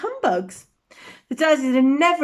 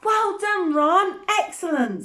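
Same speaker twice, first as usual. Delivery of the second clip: pompous; 6 semitones higher, slower than usual. Acted.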